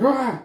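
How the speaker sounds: short, high, loud imitated scream; mock scream. Officer startled by someone in the chair, mock scream.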